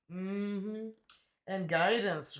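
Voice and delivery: normal talking voice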